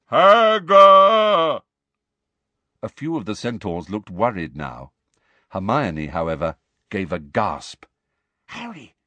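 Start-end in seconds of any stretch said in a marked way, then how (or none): 0.1-1.6 huge, high, drawn-out roar; giant's bellow. A narrator's reading. Giant demanding someone he misses, full bellow. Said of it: "then bellowed". 8.5-9.0 very soft, high whisper. A narrator's reading. Frightened girl alerting her friend, hushed whisper. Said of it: "she whispered".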